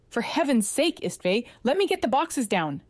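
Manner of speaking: snapping. A narrator's reading. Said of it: "he snapped".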